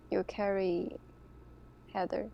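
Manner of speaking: ordinary talk, nothing marked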